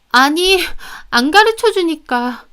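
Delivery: high, whiny justification; defensive excuse. Acted. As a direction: make a feeble excuse when caught. Caught sister makes whiny excuse.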